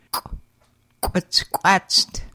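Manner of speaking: "quatched" with a popped kw; hard glottal kw. Shown as a demonstration. Teacher modelling glottal rounded K for learners, hard kw. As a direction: pop the "qu-" hard. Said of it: "pronounced as a hard qu".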